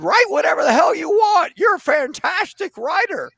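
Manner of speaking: a hammed-up Jimmy Stewart editor praising the writer. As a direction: crow the compliment like the old-time editor. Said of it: "sound like this".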